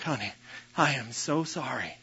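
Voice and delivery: soft, mildly tearful imitation of apologizing; retold tearful apology. Husband retelling his apology to his wife, softly tearful. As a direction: apologize quietly, with a touch of tears. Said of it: "tears are running down my face".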